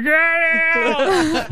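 high drawn-out stoned yeah; mock sleepy yeah. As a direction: imitate a stoned friend. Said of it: "he's like".

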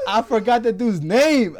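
slow, loud and high through real laughter; laughing. Real, not acted. Telling friends about the call, slow laughing words. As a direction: let the laugh carry the words.

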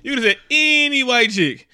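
ordinary delivery

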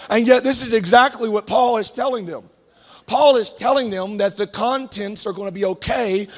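normal talking voice

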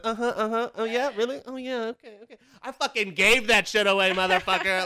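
high, mock sweet then loud swearing; sarcastic placating. To her partner at dinner, sarcastic then loud. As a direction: fake agreement that bursts into a curse. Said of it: "I'm like".

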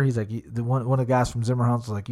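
ordinary delivery

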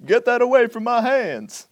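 high-pitched comic protest, pushed out in an impression; exaggerated high celebrity impression, indignant; Chris Rock. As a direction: imitate a comedian's squawked, alarmed objection. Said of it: "better than any of my, uh, attempts at Chris Rock".

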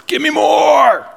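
high, loud greedy shout; greedy demand. Acted. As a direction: cry out as if starved for it. Voicing greedy craving for affirmation.

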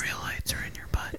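hushed whisper. Pretend. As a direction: whisper it secretly. Whispered joke.

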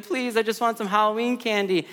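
put-on whiny child begging; hammed-up little-boy whine; mock crying son. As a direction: imitate a tearful kid pleading at the dinner table. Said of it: "and he's crying".

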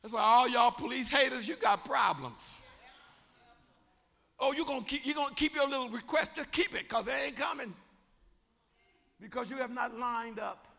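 building sermon shout; preaching. Acted. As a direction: preach it to the back pew at full force. Preaching at full force.

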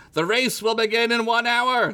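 high, grand herald call; proclaiming announcer. Acted. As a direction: proclaim it to the crowd. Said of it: "the announcer proclaimed".

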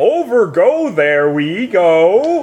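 high, loud, very slow strange speech; future English. Pretend. Future person speaking garbled future English. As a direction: stretch it out like garbled future talk. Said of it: "It'd be like".

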